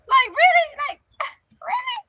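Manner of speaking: high laughing Like, really?; high surprised disbelief. Real, not acted. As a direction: squeal out the disbelief. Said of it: "mock disbelief".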